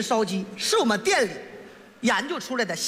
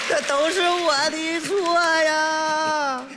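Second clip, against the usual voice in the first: long drawn-out mock wail; fake crying; 3 semitones higher, much slower than usual. Young man fakes a tearful customer apologising to the shop manager, mock wailing. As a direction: sob out a very slow, hammed-up apology. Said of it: "你，你还哭吗？".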